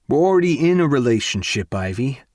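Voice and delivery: loud outburst. Acted. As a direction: raise your voice so heads turn. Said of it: "My voice bounces over the walls".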